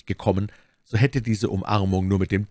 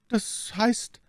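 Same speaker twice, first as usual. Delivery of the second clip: higher, drawn-out hesitant start; mild stammer. A narrator's reading. Milady stalls before the novice, mildly stammering. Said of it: "stammelte my Lady".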